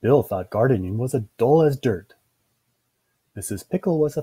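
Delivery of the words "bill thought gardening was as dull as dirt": ordinary delivery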